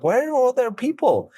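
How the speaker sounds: high-pitched incredulous question; retold bewilderment. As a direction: pitch it way up, baffled. Retelling bewilderment at the film.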